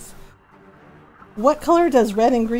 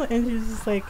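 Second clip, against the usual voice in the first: ordinary delivery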